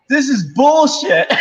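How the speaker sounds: high loud laughing yell; retold theater heckle, high and loud; imitated heckler shout. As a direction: shout it like an annoyed moviegoer. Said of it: "these people behind me go".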